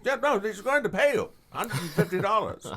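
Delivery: high, eager pitch of the mentor; teacher's offer. Retold or imitated. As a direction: sell the job like the mentor. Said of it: "He said".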